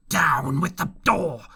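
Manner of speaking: slow, loud blind beggar's cry; a blind pirate cries out to his men. A narrator's reading. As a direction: bellow the order at the door. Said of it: "he cried".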